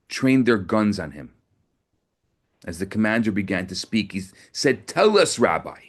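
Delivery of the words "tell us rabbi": mocking sneer. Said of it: "He sneered".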